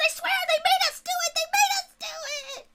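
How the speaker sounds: high, fast squeaky excuse; high squeaky goblin plea; pleading hobgoblin. Acted. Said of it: "She goes".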